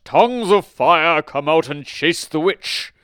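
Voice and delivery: booming, slow, high-pitched incantation; very loud, drawn-out magical command; wizard crying out. A narrator's reading. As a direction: summon the flames at full volume. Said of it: "The wizard cried out".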